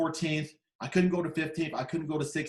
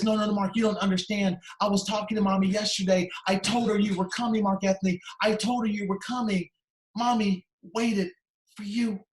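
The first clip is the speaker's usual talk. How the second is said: higher-pitched, emotional daughter, slowing at the end; retold urgent insistence; 4 semitones higher, 3 dB louder than usual. Daughter telling visitor about her mother, urgent insistence. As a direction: plead to be understood, pitch held up.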